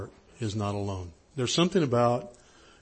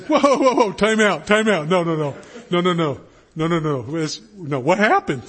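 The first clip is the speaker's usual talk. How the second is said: loud, high, rapid-fire protest; urgent interruption; 5 semitones higher, 7 dB louder than usual. Retold or imitated. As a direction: cut in hard and call a halt. Retelling himself halting friend's brush-off.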